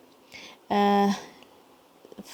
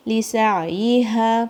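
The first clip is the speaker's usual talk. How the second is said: slow, drawn-out Quran recitation; 4 dB louder, much slower than usual. Shown as a demonstration. Teacher reciting the verse slowly for students. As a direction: chant each syllable slowly. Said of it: "یہاں پر بھی ایک common mistake".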